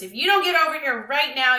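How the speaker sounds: loud, high threatening yell; angry threat. Acted. Lewis threatening his cowering dog, loud angry yell.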